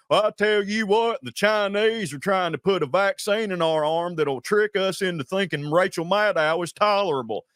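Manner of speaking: exaggerated Southern accent, dumb guy on a rant; hammed-up dumb Southern dude; dumb Southern character. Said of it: "that's an impression of an actual dude I know".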